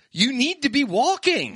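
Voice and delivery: high, slow, preachy scolding; sneering at scooter riders, high and slow; mock scolding. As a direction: lecture them in a prissy high voice. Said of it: "and I'm like".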